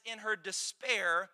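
ordinary delivery

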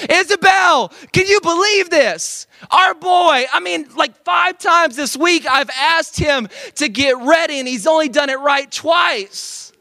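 high, loud exasperated scolding; berating rant. Acted. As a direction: yell at the kid like a fed-up dad. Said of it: "berate my son".

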